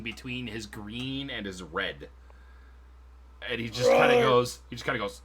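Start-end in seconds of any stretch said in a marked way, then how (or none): none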